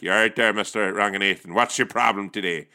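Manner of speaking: put-on brusque Northern Irish accent; Belfast accent. Call centre confronts complaining customer, Belfast accent. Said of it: "pass you over to Belfast".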